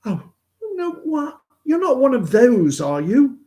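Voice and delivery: very high, aghast mock woman's voice; imitating Anu. Tennis partner shocked he is one of those, very high mock voice. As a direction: play her horrified and incredulous. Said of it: "And she goes".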